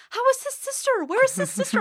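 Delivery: voicing her mom. Mom quizzes her about the classmate's sister, high prying voice. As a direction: play mom digging for gossip.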